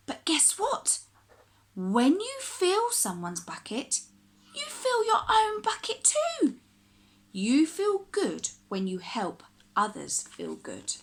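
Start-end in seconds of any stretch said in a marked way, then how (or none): none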